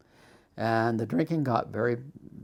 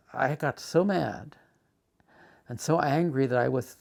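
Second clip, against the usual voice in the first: ordinary delivery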